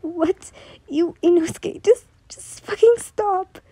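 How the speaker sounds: a broken, sobbing demand; sobbing plea. Acted. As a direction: beg him through tears. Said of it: "Yu sobbed".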